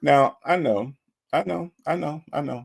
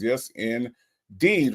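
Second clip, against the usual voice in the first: ordinary talk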